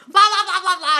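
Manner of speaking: loud, high babble through laughter; imitated shouting. Mocking own shouting at a friend in restaurants, loud and laughing. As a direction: yell it as a comic rant at a friend. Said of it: "I was shouting at her for years".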